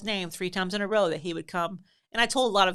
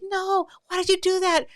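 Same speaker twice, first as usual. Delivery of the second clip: high, loud imitated whine of alarm; kids' upset protest; 13 semitones higher, 7 dB louder than usual. Kids blaming the boy, upset wail. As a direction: wail it like a horrified kid.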